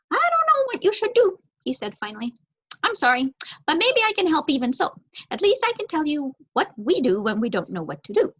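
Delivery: earnest bird trying to help; crow voice. Acted.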